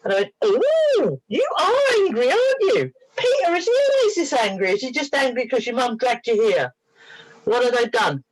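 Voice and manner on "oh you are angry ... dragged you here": put-on high-pitched baby-talk, gradually coming down; singsong, stretched high opening; cooing to something young. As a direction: coo to a tiny creature. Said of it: "give the impression it's very young".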